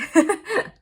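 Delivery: short bright laugh at herself. Real, not acted. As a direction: giggle at her own mishap. Laughs at own oversleeping.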